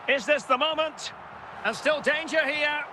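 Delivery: high, urgent match call; keyed-up football commentator; excited anticipation. Pretend. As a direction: call the attack as a goal looms. Commentator calling a dangerous attack.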